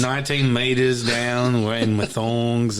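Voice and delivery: slow, drawn-out Australian impression; Australian accent. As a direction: stretch the vowels like a mock Aussie. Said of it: "we were doing Australian people".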